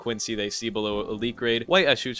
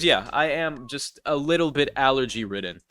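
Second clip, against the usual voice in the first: usual speaking voice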